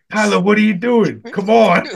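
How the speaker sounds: mock coaxing boss voice; Frank impression. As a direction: imitate a boss calling his favorite over. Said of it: "Frank would come over and be like".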